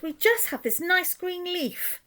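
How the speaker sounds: very high tiny ant voices. A narrator's reading. Ants answer the robber rat, very high tiny voices. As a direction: squeak it like little insects. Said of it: "the ants replied".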